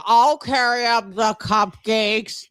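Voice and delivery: slow, dopey half-Bubba drawl; Hoppe impression. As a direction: play him as a slow, dim good old boy. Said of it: "your Hoppe impression is, like, half Bubba".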